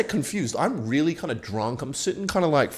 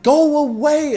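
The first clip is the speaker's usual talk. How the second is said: much higher and louder girl imitation; high mock girl voice; 7 dB louder, much slower than usual. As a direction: shoo him off, squealing.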